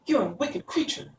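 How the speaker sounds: put-on high, indignant girlish voice; girl crying out. A narrator's reading. Dorothy accuses the witch of wickedness, indignant cry. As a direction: protest as a little girl, pitched well up. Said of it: "cried Dorothy".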